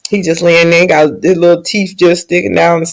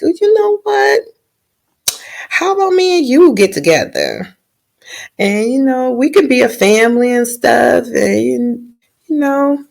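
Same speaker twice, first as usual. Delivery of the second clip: pitched-up pleading come-on, lightly voiced; mock sweet-talking suitor; 7 semitones higher than usual. Knight begging princess to run off, pitched-up mock wooing. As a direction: sweet-talk her into running away.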